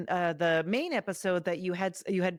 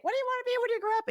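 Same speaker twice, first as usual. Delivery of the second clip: high, fast sing-song adult voice; mock cheerful adult to a little kid; adult asking a kid; much faster than usual. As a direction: chirp it like a grown-up quizzing a child. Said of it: "people are always like".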